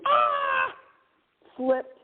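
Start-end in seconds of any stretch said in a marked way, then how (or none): 0.1-0.7 high, breathy mock scream; reenacted scream. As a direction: imitate your own scream on slipping. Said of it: "I was screaming".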